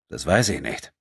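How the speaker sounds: understated, distant reply; cool. A narrator's reading. Robbed man to apologising thief, mildly cool. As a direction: answer evenly, withholding sympathy. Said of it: "sagte André kühl".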